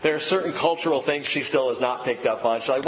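ordinary talk, nothing marked

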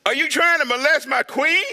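high, loud accusing king; angry king. Acted. Voices the king.

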